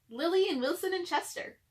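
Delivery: ordinary delivery, nothing marked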